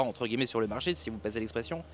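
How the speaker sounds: usual speaking voice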